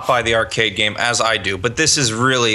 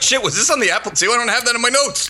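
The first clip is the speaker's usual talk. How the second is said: high, fast panicked self-quote; 8 semitones higher, faster than usual. Retold or imitated. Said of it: "I was like".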